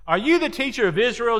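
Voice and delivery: acting out an incredulous teacher's challenge; challenging question. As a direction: question him in disbelief. Jesus challenging Nicodemus, acted.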